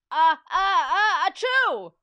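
loud, high fake sneeze, drawn out; hammed-up crowd sneeze; mimicked sneeze. A narrator's reading. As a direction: build up uh by uh to a big achoo. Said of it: "repeated the fans".

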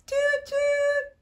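high, bright train whistle toot. Shown as a demonstration. Train sound for kids.

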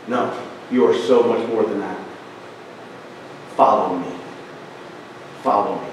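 soft, low, unhurried invitation; gentle call. Retold or imitated. Jesus tenderly calls Peter to follow him, soft and low. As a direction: call him quietly, like a kind master.

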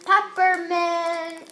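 drawn out reveal. Real, not acted. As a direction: stretch the word out.

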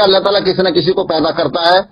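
normal talking voice

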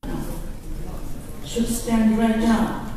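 rising, declaiming poem reading; poem recital. Acted. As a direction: read it like a defiant anthem to an audience. Reads her translated poem aloud.

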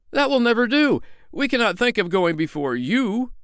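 high, alarmed polite protest; hasty refusal. Acted. Tinker and tailor beg off leading, alarmed. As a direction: wriggle out of going first. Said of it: "said the tinker and the tailor".

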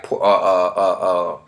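ordinary delivery

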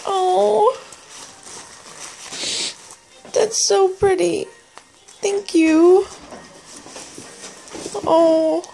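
high, choked-up and teary over a gift; tearful. Real, not acted. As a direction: voice breaking with real emotion. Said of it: "I don't wanna cry".

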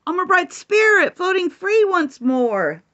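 high, building to a cheer; excited kitty. Acted. Freed kitty spirit cheering, excited. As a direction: squeal with joy as you float. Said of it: "Kitty Cat said".

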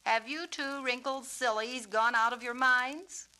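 loud, sharp teasing scold; sharp mother. A narrator's reading. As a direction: scold playfully, a sharp edge. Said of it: "Her voice was sharp".

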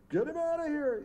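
high, loud barked order; mock order. Studio boss ordering the drunk actor out, high loud shout.

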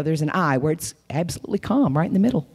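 ordinary talk

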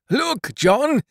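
high, triumphant call; exultant. A narrator's reading. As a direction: call him over high with joy. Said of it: "said Caleb exultingly".

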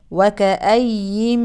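وكأين slow with the nun merged into من; connected reading. Shown as a demonstration. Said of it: "تدغم النون الساكنة في الميم". (إدغام عند الوصل)

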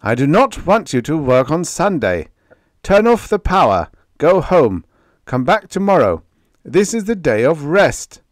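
high, loud commands over the noise; shouted orders. A narrator's reading. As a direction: bark out the orders to the whole floor. Master shouting orders over din.

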